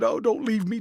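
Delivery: high, pleading cry; desperate plea. A narrator's reading. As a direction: beg him to stay. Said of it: "A fit of passionate sobbing".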